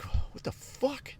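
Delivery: breathless slow what the fuck; aghast whisper at the tiny woman; stunned whisper. Retold or imitated. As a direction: whisper it in disbelief. Sees tiny person.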